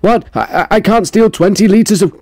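high, puzzled voice for the confused man; confused guy. Retold or imitated. Vlogger voicing a confused man stealing coke, high and baffled. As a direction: whine it out, baffled at the machine. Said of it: "I like how he's confused".

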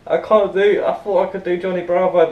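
ordinary delivery

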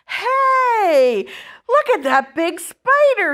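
put-on high, loud boyish exclamation; excited shout. A narrator's reading. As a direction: call out in amazement at what you've spotted. Boy spots a huge spider.

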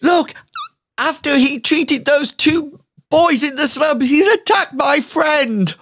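high outraged accusation; high indignant woman filming; accusing filmer. Acted. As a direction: call him out for the camera. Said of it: "you can hear her saying".

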